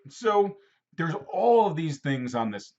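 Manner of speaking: ordinary delivery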